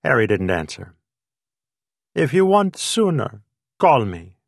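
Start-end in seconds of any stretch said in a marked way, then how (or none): 2.1-4.4 put-on Greek accent. A narrator's reading. Greek waiter keeps his accent.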